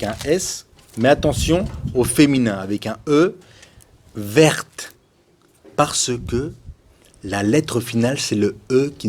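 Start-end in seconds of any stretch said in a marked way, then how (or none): 4.1-4.9 "verte" with a clearly released final t; crisp t closing "verte"; final T pronounced. Shown as a demonstration. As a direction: say "verte" right through to the t. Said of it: "on le prononce jusqu'au T".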